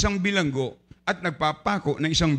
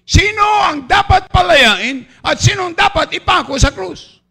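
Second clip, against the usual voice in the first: much higher, loud shouted challenge to the crowd; shouted question; 10 semitones higher, 9 dB louder than usual. Acted. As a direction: fling the question at the mob. Preacher voicing Pilate's question.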